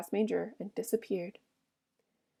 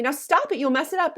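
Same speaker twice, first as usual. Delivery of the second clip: higher, louder, urgent protest; angry cry; 6 semitones higher, 6 dB louder than usual. A narrator's reading. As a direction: cry out angrily to make him stop. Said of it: "then she cried angrily".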